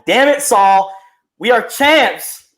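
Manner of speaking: high, slow, drawn-out triumphant cry; excited boast. Real, not acted. Lakers fan rubs the title in to Saul, excited boast. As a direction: crow it proudly at a rival fan. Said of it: "as excited about that as you are".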